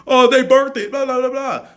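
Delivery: high, sneering mock voice for the other side's excuse; mocking whine. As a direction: parrot them in a silly high voice, then brush it off.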